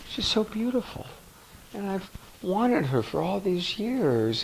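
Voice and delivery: soft, high, fragile admission of sadness; vulnerable. Shown as a demonstration. Coach models a vulnerable man naming his sadness, soft and high. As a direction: speak it as a hurting man owning his feelings. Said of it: "Notice how much more vulnerable that is".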